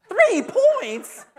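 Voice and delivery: can't believe the score; outraged double-take; shocked disbelief. Retold or imitated. Man shocked by the low score.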